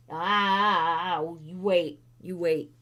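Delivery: normal talking voice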